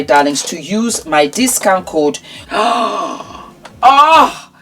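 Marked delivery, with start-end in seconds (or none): 2.5-4.5 surprised gasp at the product; delighted gasp. Real, not acted. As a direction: gasp as if seeing treasure. Unveiling the beauty case.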